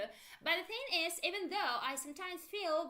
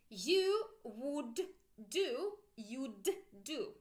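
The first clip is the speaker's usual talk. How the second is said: slow, careful "You would do, you'd do"; slow drill; much slower than usual. Shown as a demonstration. Teacher modelling forms for students to repeat slowly. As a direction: draw out each form for repetition. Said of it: "Make sure you pronounce with me".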